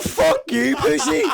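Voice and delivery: high, loud shouted retort; shouting back. Retold or imitated. Boxer retelling how he yelled back at his rival, high loud shout. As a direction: fire the insult straight back.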